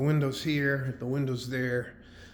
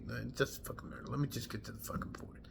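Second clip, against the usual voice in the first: much softer, fast whisper to himself; annoyed aside; 12 dB quieter, faster than usual. Real, not acted. Veteran cutting himself off, muttering fast and annoyed. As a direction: brush it off under your breath.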